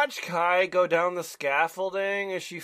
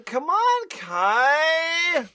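calling out; 8 semitones higher, much slower than usual. Acted.

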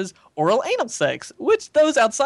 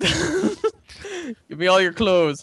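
Terminator voice; 2 semitones higher, much slower than usual. Pretend. Terminator quote.

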